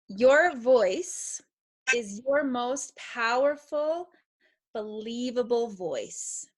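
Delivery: slow, with YOUR stressed on each rise; slow emphatic statement. Shown as a demonstration. Motivational speaker to kids, slow and emphatic. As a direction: deliver each phrase slowly, leaning on YOUR. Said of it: "listen to this next statement really carefully".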